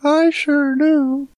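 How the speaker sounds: very high, slow cartoon-kid voice; squeaky goofy kid voice; high silly voice.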